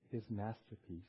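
usual speaking voice

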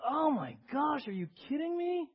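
high, stretched-out disbelief; mock exasperation. Preacher voices someone reading bad news, mock exasperation. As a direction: act out being appalled, pitch climbing.

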